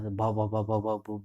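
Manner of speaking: repeated bha, imitating a stammer; mock stammer; stammer demo. Shown as a demonstration. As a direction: stutter on the first sound. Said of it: "एक word बोलना होता था".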